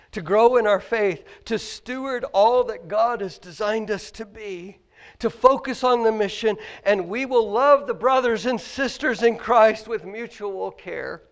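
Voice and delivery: loud, ringing pulpit questions; preaching at full force. Acted. Pastor challenging the congregation, loud preaching. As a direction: hammer each question home to the church. Building to the call.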